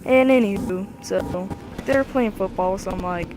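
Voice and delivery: usual speaking voice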